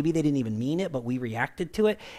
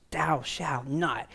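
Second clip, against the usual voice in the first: God's commandment; 4 dB quieter, much slower than usual. Retold or imitated. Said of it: "began writing in tablets".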